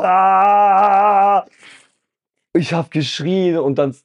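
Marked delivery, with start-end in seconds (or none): 0.0-1.5 long, loud, higher "Aaah!"; sustained open-throated war yell; battle-cry scream. Retold or imitated. Charging into battle between armies, long war scream. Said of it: "ich schrei einfach".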